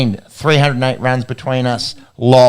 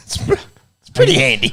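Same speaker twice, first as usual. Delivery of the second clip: high squeaky laugh through words; giggling as he repeats it; 13 semitones higher, faster than usual. Real, not acted. Laughing repeat of own joke.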